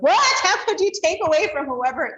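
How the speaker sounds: dramatic scolding. Shown as a demonstration. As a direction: blow up at the child with drama. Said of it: "and then we're like".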